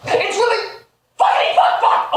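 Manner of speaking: furious outburst; angry shout. Real, not acted. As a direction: yell it in frustration. Furious outburst about the movie.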